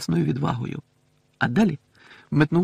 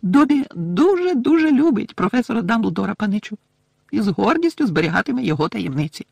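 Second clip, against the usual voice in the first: high, loud devoted elf; proud little elf piping up; squeaky, fervent servant elf; Dobby the house-elf, proud; 9 semitones higher, 4 dB louder than usual. Acted.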